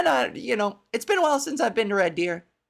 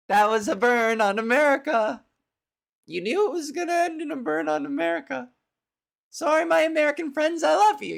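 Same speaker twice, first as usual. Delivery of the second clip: gloating; 5 semitones higher than usual. Pretend. Gloating mock cheer over roasting America.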